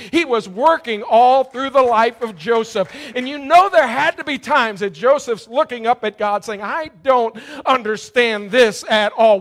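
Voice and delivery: loud, high, climbing sermon delivery; full-force preaching. Acted. Preacher urges the hurting congregation, full-force preaching. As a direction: preach it out to the back row.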